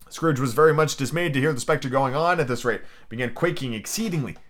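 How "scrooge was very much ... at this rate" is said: ordinary delivery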